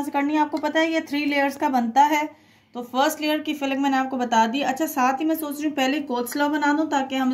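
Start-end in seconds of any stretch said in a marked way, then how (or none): none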